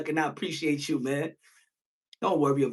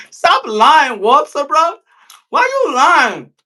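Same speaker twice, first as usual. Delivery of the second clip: 1 raised call-out; 6 semitones higher, 7 dB louder, slower than usual. Real, not acted. Calling out Walter for lying.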